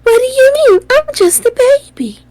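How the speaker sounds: put-on high squeaky baby whine. Mocking the queen's baby character in a high voice. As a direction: pout like a helpless little baby. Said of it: "if you'd have did the really deep voice".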